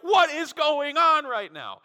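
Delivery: slow, high, loud mock disbelief; drawn-out, astonished question; bewildered onlooker. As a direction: stretch out the confusion. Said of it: "And you're like".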